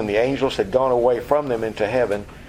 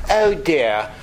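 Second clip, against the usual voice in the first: put-on prim, composed voice; mock stuffy understatement of big news; mock unhurried propriety; 3 semitones higher than usual. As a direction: play it absurdly formal and unruffled. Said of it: "They're not gonna talk like that".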